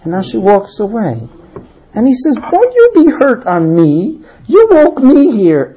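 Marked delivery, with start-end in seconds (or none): 2.4-5.7 slow, raised-pitch reproach; frustrated warning. Acted. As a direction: spell out the grievance slowly. Frustrated husband warning wife.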